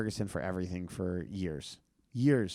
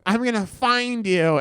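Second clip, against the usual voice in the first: much higher, louder menacing shriek; shrill threat; 12 semitones higher, 8 dB louder, slower than usual. Retold or imitated. Danny threatens to track the comedian down, high and loud. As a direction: threaten him in a high rage. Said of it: "but he went".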